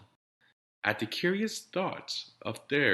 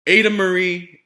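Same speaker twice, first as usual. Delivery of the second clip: loud, higher full-name scolding; scolding yell; 7 semitones higher, 11 dB louder than usual. Acted. Exasperated parents scolding their daughter, a loud angry yell. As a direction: call the child out by her full name. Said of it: "her parents yelled".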